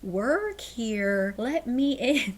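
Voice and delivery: very slow, stretched-out words, as if to a foreigner; pantomime speech. Acted. Maid to landscaper who speaks no English, in slow pantomime speech. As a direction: spell it out very slowly for someone without English. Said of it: "doing the pantomime".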